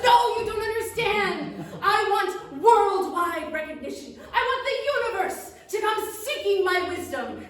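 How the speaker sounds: high, loud, grumbling gnome. Acted. Gnome confesses to the witch he hates serving steaks, high and loud. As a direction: complain loudly like a tiny food-hating gnome. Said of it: "He says".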